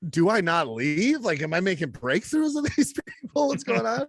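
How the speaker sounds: mock bewilderment. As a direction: ask in amused disbelief. Mock self-doubt about leaving.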